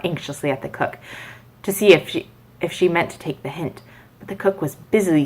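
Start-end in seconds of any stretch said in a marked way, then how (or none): none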